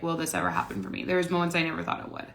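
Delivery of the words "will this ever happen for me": much lower and softer, quick, as if asking herself; a hushed, doubting inner voice; self-doubting murmur. Retold or imitated. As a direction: mutter the old fear under your breath. Quoting her old self-doubt.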